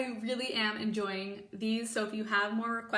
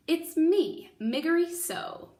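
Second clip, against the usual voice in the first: loud and higher, calling out; shouting; 7 semitones higher, 7 dB louder, slower than usual. Acted. Mig shouts her return to the cook. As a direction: Mig announces herself with a shout. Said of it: "she shouted".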